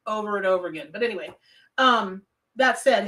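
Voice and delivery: normal talking voice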